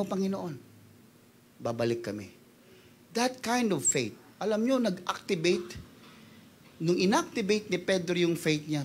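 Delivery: soft sermon storytelling. A narrator's reading. Pastor quietly retelling Peter's story to the congregation. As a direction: tell it quietly, intimately.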